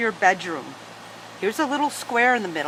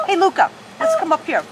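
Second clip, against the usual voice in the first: calling the dog; 8 semitones higher, 5 dB louder than usual. Real, not acted.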